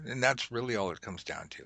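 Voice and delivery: usual speaking voice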